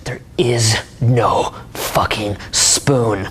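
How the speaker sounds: dramatic revelation. Acted. Retelling the Matrix's big reveal, in a deep slow voice. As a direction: drop the voice deep and drag it out.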